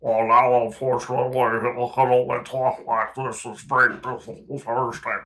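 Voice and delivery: a senator's altered voice after his fall; Mitch McConnell voice. Retold or imitated. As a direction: impersonate an old senator after a concussion. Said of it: "it also affected his voice".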